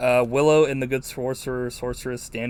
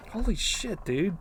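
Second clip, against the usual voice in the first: stunned; 7 semitones higher, 4 dB quieter than usual. Retold or imitated. Reviewer's own stunned reaction.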